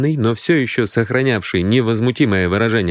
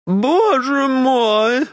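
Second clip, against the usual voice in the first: very high, drawn-out wail; plaintive; much slower than usual. A narrator's reading. Companion suddenly interrupts the scandal, plaintive. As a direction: wail it slowly in a thin high voice. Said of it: "раздался вдруг жалобный голос".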